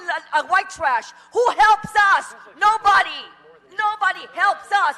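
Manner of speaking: pissed-off shouting at the council; angry yelling. Real, not acted. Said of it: "you wonder why I come here and fucking yell".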